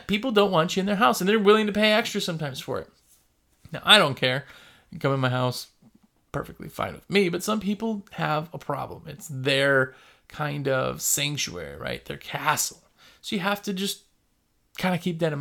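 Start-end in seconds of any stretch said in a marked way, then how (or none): none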